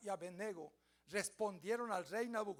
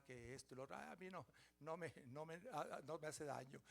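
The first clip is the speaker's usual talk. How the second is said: softer, careless shrugging tone; mock complacent believer; 3 semitones lower, 8 dB quieter than usual. Preacher mocking a complacent believer: soft, careless shrug. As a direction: play the believer who thinks nothing can touch him.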